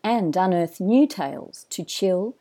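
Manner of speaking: polished announcer read over music; smooth, measured podcast host intro. Acted. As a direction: draw the listener in like a show opener.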